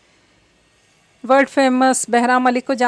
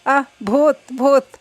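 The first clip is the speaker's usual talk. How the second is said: louder, rising panicked cry of ghost; frightened scream; 5 dB louder, slower than usual. A narrator's reading. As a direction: shriek in fright at a sudden voice in the dark. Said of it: "हानिया की चीख बुलंद हुई". (डरकर ज़ोर से चीखती हुई)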